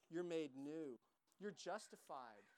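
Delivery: ordinary delivery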